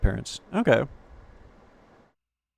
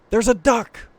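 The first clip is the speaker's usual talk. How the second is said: surprised; 9 semitones higher, 6 dB louder, slower than usual. Real, not acted. Sudden spot on screen.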